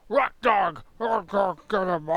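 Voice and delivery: loud, growled orc gibberish; high-energy monster shouting; Orcish speech. Acted. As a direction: bark it out like an orc in battle. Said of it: "if they're actually speaking Orc".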